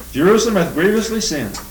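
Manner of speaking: measured, solemn Bible reading; scripture reading. Acted. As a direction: read the verses out to the congregation. Quotes scripture.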